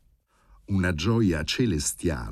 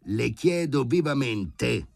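growled; 6 semitones higher, 4 dB louder, slower than usual. A narrator's reading. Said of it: "ringhiò Filip Filipovic".

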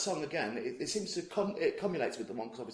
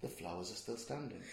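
quiet quote; 6 semitones lower, 12 dB quieter than usual. Shown as a demonstration. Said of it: "You've said it three different ways".

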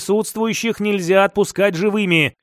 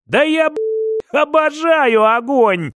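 gleeful; 6 semitones higher than usual. Acted. Captive relishes fire, mocking captors.

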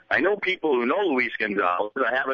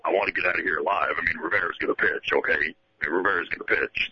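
lightly lowered manager grumble; Jim Leyland impression; 6 semitones lower than usual. Sports host imitating Jim Leyland in the bullpen meeting, low. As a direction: lower gruff manager voice, fast at first. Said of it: "the Jim Leland impersonator".

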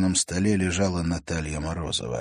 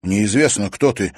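loud gasped exclamation; 3 semitones higher, 7 dB louder than usual. A narrator's reading. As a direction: blurt it out in shock. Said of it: "выдохнул он".

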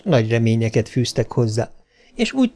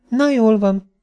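put-on high little-girl voice, conceding; child giving in; 10 semitones higher, slower than usual. A narrator's reading. Girl gives in to mother's threat, reluctantly. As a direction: yield reluctantly, pitched well up.

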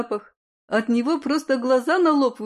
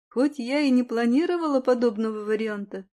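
ordinary delivery

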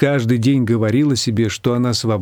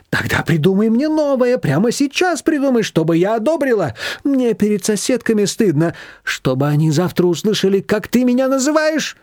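put-on higher, insistent old woman's voice; demanding mother-in-law; 9 semitones higher than usual. A narrator's reading. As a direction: demand a new name right now. Manana demands a name.

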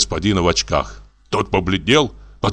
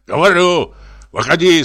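barked command, loud and high; shouted order; 8 semitones higher, 5 dB louder than usual. Acted. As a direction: drunk reveller ordering them out. Drunk ordering people out.

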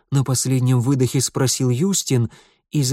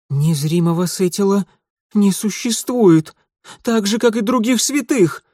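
loud, high defiant shouting; 8 semitones higher, 4 dB louder than usual. Acted. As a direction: shout your defiance at him.

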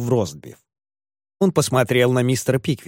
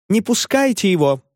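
women's shriek; 9 semitones higher than usual. A narrator's reading. Women beg others to hold the master back, mock shriek. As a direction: plead in a raised, squealing pitch. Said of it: "завизжали все женщины".

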